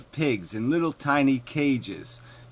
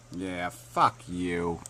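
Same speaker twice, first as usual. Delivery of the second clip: low contempt; 8 semitones lower, 7 dB quieter, much slower than usual. Real, not acted. Contemptuous sign-off to opponent.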